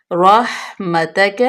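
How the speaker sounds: رحمتک slow with a clear ح from the throat; clear throat letter. Shown as a demonstration. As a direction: open up the ح in رحمتک, slowly. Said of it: "ہا کا مخرج واضح کریں". (رحمتک آہستہ، حا کا مخرج واضح)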